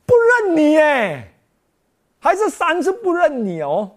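mocking tempter at full pitch; taunting devil. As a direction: sneer at him as the tempter. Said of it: "魔鬼试探他".

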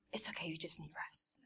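hushed, soft near-whisper; mom's restrained reassurance. Retold or imitated. Mom settling her sick child in bed, quietly reassuring. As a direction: soothe quietly, holding the reaction back. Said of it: "she's trying not to react".

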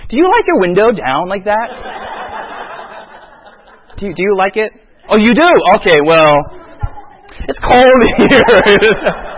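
fake-sweet hints that you are cold; passive-aggressive hinting. Shown as a demonstration. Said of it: "the passive form of communication is".

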